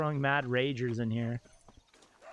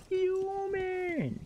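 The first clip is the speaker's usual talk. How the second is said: mock fuming. Real, not acted. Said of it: "I'd be fuming".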